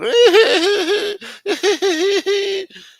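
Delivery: loud shrieking laugh; real high laughing fit; high cackling laugh. Real, not acted. Laughing at own story.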